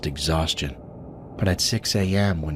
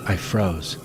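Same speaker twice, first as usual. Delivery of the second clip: plain reading voice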